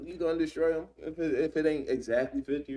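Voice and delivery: a hint of a nervous bargainer asking the Lord; pleading Abraham. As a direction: coax the Lord, nervous and high. Abraham pleading, voiced higher.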